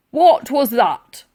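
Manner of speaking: a suspicious soldier demanding answers; loud slow gruff demand. A narrator's reading. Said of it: "demanded the sergeant major".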